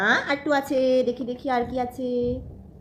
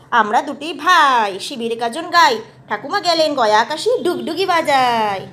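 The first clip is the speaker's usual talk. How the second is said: put-on reading to a toddler; 7 dB louder than usual. Mother reciting rhyme to toddler.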